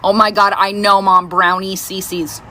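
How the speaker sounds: weary daughter who has heard it all; eye-rolling exasperation. Retold or imitated. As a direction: imitate a daughter fed up with Mom's story. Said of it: "she'll roll her eyes at me".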